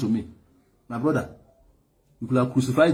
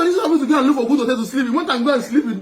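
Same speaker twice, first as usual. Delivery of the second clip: fast, high words tumbling out through laughter; laughing; 9 semitones higher, 3 dB louder, faster than usual. Real, not acted. Laughing hard as he retells the leader's plan.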